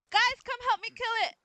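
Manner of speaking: shouting for help. Real, not acted. Calling friends for help in game.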